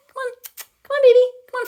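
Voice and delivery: high loud call to the bird; high loud coax. Real, not acted. Coaxing her parrot.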